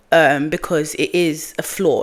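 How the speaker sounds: ordinary talk, nothing marked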